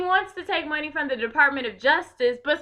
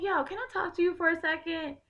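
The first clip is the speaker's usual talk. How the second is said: put-on softer, easy talk to a kid on the bus; calm gentle approach; 4 semitones higher, 4 dB quieter than usual. Speaker calmly addressing rowdy kids on the train, soft and gentle. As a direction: reach them gently, not crazy. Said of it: "I don't come at them crazy".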